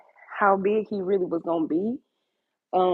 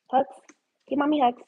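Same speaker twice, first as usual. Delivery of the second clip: sweet pet voice; 7 semitones higher, faster than usual. Real, not acted. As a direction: coax the dog up high. Baby talk to dog.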